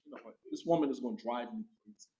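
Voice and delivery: very soft, retold grumble; exasperated muttering. Pupil fed up with this woman, muttered exasperation. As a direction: mutter it under your breath. Exasperated aside about the teacher.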